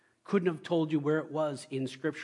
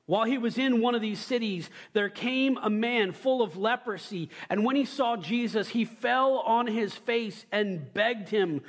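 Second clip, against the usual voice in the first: higher, measured Bible reading that slows down for the plea; scripture reading; 6 semitones higher, 3 dB louder than usual. Acted. Preacher reading Luke aloud to the congregation, slowing on the plea. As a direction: read the verse with weight, dragging the leper's words.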